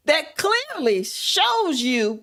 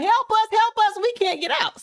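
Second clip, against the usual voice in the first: calling for help; 6 semitones higher than usual. Retold or imitated. Trapped women calling for help.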